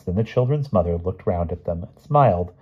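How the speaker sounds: ordinary delivery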